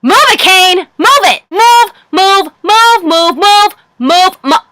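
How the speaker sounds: high-pitched, rapid-fire repeated command; high, impatient mock nagging, cut off abruptly; impatient inner rant. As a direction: chant it frantically, as if urging someone along. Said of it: "I was just thinking".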